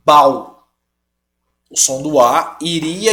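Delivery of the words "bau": Bau as one syllable, the au merged, a bit louder; wrong diphthong. Shown as a demonstration. Teacher showing how the word sounds without the accent. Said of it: "fica como".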